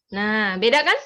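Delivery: ordinary talk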